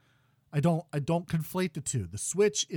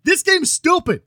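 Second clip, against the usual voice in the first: higher, louder angry shout; a real rant that peaks in a yell; angry outburst; 13 semitones higher, 11 dB louder than usual. Real, not acted. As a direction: blast the game at full volume. Ranting about the game he hates.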